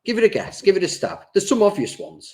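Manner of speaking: high-pitched, playful urging; coaxing. Real, not acted. Quizmaster urges co-host to guess the teams, high coaxing. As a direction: nudge a reluctant guesser to have a go.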